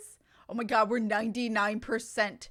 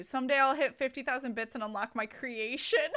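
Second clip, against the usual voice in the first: ordinary talk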